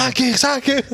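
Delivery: squeaky eager blurting; high-pitched and eager; high eager caller. Retold or imitated. Meme caller voice.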